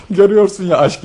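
laughing. Acted. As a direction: let the laugh carry the line. Laughs while answering friend. (gülerek söyler)

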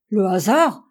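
American outraged at the insult, exclaiming loudly. Acted. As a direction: bristle at the word. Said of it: "s'écria Altamont".

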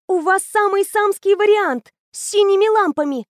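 put-on high, bright-eyed girl raving about a machine; eager teenage girl. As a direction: gush like a know-it-all teen. Anya voiced high, eager.

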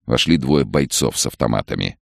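ordinary delivery, nothing marked

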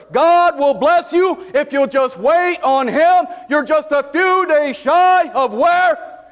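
high, loud, slow preacher cadence; preaching. Acted. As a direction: preach it out, rising to a shout. Preaching at full force.

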